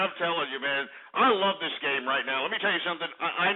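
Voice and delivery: usual speaking voice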